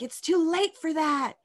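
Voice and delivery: high, loud, slow outburst; retold scream. The girl yelling at her mother that it's too late, loud and slow. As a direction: hurl it back at her mother. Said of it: "screaming at her in her face".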